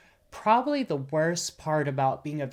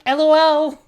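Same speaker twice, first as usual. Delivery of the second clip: high, loud, gleeful shout; hammed-up high holler; mock commenter yell; 12 semitones higher, 12 dB louder than usual. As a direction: blurt it out like an online crowd. Mimics commenters laughing it off.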